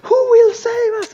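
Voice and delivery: very high, very loud theatrical plea; hammed-up cry for rescue at full volume; panicking inn staff. Staff begging the room for a hero, loud stage panic. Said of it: "all of the staff goes".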